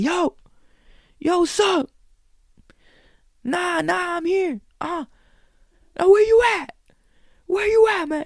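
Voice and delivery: high, loud, careless phone-call voice; heckler on phone. Retold or imitated. Heckler answering his phone in a silent room, loud high chatter. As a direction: take the call like nobody's watching. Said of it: "He's like".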